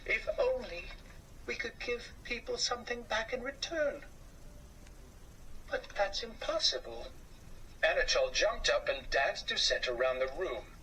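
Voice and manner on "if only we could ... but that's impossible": higher, soft, wistful wife voice; a touch of sadness in a small mouse wife; mild sadness. Acted. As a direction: sigh it gently. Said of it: "she said sadly".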